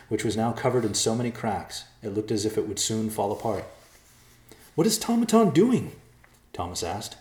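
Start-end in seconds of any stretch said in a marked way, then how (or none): 4.7-5.9 high, loud bewildered question; alarmed question. A narrator's reading. Thomas asks the group about the pounding, alarmed. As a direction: ask it high and urgent.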